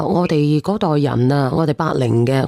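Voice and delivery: ordinary delivery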